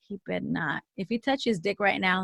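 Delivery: low, hushed retold inner warning; quiet suspicious thought. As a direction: think it aloud under your breath. Retold suspicious thought while watching him sleep.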